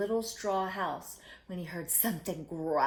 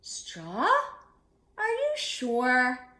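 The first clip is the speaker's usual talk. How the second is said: slow, higher doubtful question; doubtful pig; 5 semitones higher, 4 dB louder, much slower than usual. Acted. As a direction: question it with worry. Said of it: "asked Percy".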